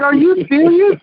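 high-pitched retold disbelief; incredulous. Friend reacting to the housekeeper story, incredulous. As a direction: act out your shocked reaction.

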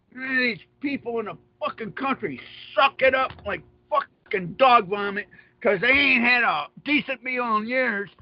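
usual speaking voice

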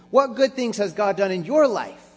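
high, loud call to go home; rally them with each line; preaching at full force. Acted. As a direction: pastor sending the church out, loud and high.

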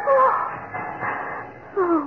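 choked-up, tearful devotion; emotional swoon over her general. Acted. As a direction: speak through tears of joy. Tearful love scene with ring.

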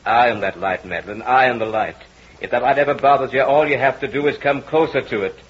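insistent, swelling hypnotic voice; hypnotist's commands. Acted. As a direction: pull her in with every command. Hypnotist commanding the entranced wife.

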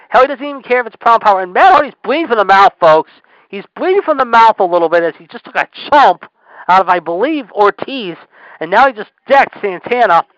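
animated, punchy wrestling call; live play-by-play. Real, not acted. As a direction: call the action with rising urgency.

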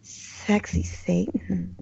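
slow, soft sultry voice; sexy Satan. Pretend. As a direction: purr it seductively. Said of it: "We got sexy Satan over here".

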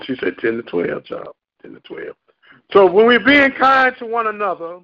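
ordinary delivery

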